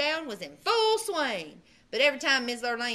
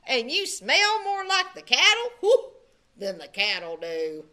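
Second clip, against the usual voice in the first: taunting; 6 dB louder than usual. A narrator's reading. Brother taunting Bubba.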